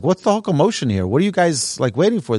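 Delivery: a bewildered traveller asking the crowd; a passenger bewildered by the waiting crowd; puzzled question. Retold or imitated.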